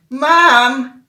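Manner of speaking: loud call for mom; yelling for mom. Retold or imitated. Child calling loudly for mom at night. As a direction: shout it through the house. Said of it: "Ich muss der Mom schreien".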